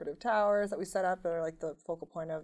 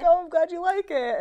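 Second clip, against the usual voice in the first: excited; 12 semitones higher, 14 dB louder than usual. Retold or imitated. Giddy reply to singer.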